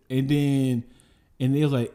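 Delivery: ordinary talk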